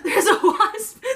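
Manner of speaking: high, slow, laughing alarm; laughing panic. Real, not acted. Startled by a wasp, laughing in alarm. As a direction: squeal it out through laughter.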